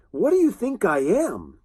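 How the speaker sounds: put-on offended girl snapping back; a hint of an angry young woman; mock angry retort. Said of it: "And she's very angry".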